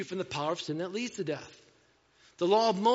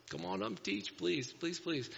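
hushed pleading; 7 dB quieter, slower than usual. Pretend. Churchgoer inviting Jesus up to teach, hushed pleading. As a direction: beg politely under your voice.

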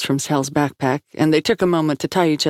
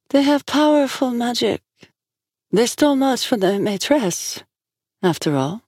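oracle in trance; 8 semitones higher than usual. A narrator's reading.

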